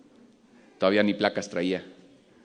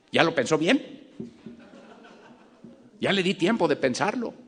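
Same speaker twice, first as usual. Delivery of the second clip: mocking cop; 8 semitones higher than usual. Cop pressuring again, laughing.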